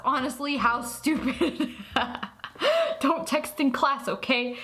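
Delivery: normal talking voice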